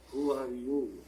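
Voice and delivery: put-on a low, accented demand from a stranger at the door; Garbo's deep accent. Garbo at the door questioning the houseboy in a deep accented voice. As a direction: give it a heavy foreign accent, cool and imperious. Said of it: "she says in this really deep accented voice".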